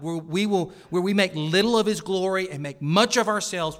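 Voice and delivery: strong pulpit emphasis; preaching at full force. Real, not acted. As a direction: press the warning hard on the congregation. Warning against irreverent worship.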